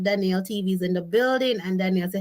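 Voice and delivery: ordinary delivery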